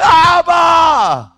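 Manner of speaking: huge, high drawn-out shout; crying out. Acted. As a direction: cry out to the Father with everything. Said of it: "He cries out when you can't even".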